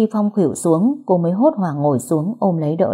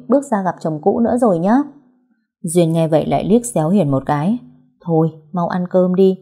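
plain reading voice

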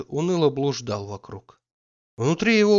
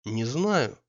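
cold. A narrator's reading. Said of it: "и ещё холоднее говорит своё".

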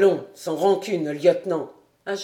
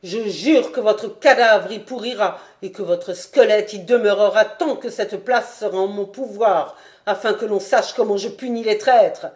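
furious threat; 8 dB louder than usual. A narrator's reading. Lord threatens to hang lieutenant.

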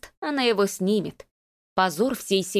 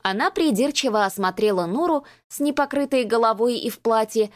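usual speaking voice

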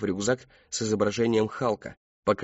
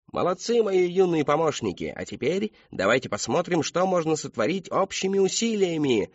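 high, hearty showman praise; pitched-up, lilting party-host voice; clown entertainer; 7 semitones higher than usual. A narrator's reading. Clown thanks his young helpers, playful showman.

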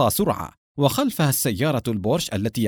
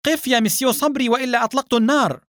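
high, urgent inspector's command; shouted warning; 10 semitones higher than usual. A narrator's reading. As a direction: call out a threat in a raised pitch. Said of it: "صاح المفتش". (صيحة تحذير بنبرة مرتفعة)

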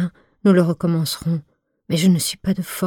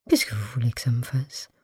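quick, hushed shrug of a line; hushed, disdainful brush-off; disdainful indifference; 4 semitones lower, 5 dB quieter, faster than usual. Acted. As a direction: brush him off, quietly scornful. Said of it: "une si dédaigneuse indifférence".

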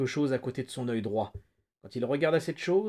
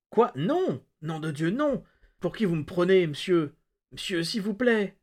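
higher-pitched, pleading outrage; indignant protest; 6 semitones higher, 4 dB louder than usual. A narrator's reading. Prisoner denies Flagg's accusation, indignant and pleading. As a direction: deny it hotly, then beg.

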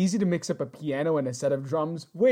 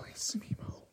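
soft, voiceless whispered question; 16 dB quieter than usual. Pretend. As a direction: whisper back, playing along with the secret. Co-host whispers back.

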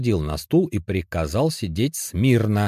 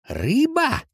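high, incredulous raised question; 4 dB louder than usual. A narrator's reading. Тихонов в изумлении переспрашивает напарника, raised incredulous voice. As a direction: shoot up in disbelief. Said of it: "Тихонов невольно повысил голос".

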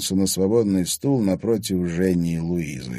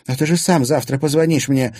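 indignant, louder and higher; 8 semitones higher, 6 dB louder than usual. A narrator's reading. Milana objects that Anton will call tomorrow, indignant. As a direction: protest with mild outrage. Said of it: "возмутилась она".

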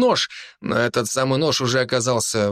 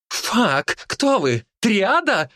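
alarmed shout rising much higher; 9 semitones higher, much slower than usual. A narrator's reading. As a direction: yell it out in shock. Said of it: "выкрикнул красавчик".

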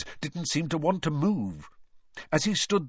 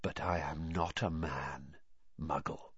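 very soft, low voice; barely audible, cold; 6 semitones lower, 10 dB quieter than usual. A narrator's reading. Cold master answers the challenge, barely audible. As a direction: answer low and coldly hushed. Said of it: "barely audible now".

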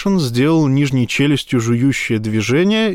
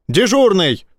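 yelling; 6 semitones higher than usual. A narrator's reading. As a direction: yell down the corridor. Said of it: "заорал он".

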